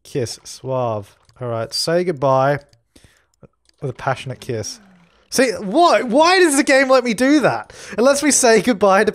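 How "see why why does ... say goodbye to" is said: much higher, louder disbelief; can't believe the game allows it; incredulous. Real, not acted. Baffled by game option.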